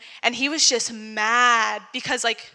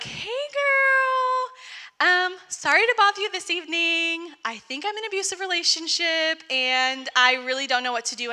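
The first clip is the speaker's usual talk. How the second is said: high, sweet, overly polite greeting; high sugary text voice; 6 semitones higher than usual. Retold or imitated. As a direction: sound sweet and apologetic, pitched up. Texting, sweet then confessing.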